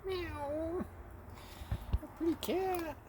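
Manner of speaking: high, soft cooing; baby talk. Acted. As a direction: croon it to the pretty cat. Cooing at the cat.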